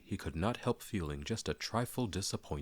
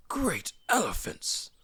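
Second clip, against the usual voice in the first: much higher, louder, slow astonished cry; Gandalf exclaiming; 5 dB louder, slower than usual. Acted. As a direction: boom out mock amazement. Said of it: "said Gandalf".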